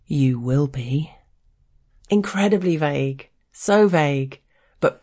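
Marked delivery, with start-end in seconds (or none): 0.1-1.1 slow, menacing murmur of a threat. Acted. He leans in after she apologises for the spilt pint, menacing. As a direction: lean in and threaten slowly, fully voiced. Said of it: "with a menacing whisper".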